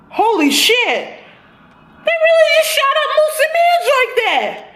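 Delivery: real high-pitched stunned laugh; shocked. Real, not acted. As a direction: squeal it in shock through laughter. Shocked reaction to the killing.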